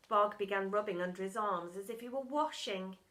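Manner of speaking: ordinary delivery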